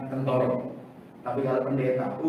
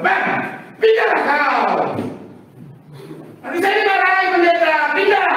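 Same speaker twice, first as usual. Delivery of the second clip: angry Batak shout; 8 dB louder than usual. Retold or imitated. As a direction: order them away loudly, Batak-style. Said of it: "orang Batak". (bentakan keras dan lambat ala orang Batak menyuruh pindah)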